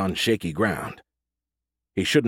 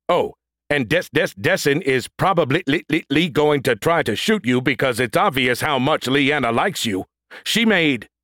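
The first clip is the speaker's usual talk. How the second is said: louder, high, stuttering robot; glitching robot stutter; 6 semitones higher, 4 dB louder than usual. Acted. Brody glitching.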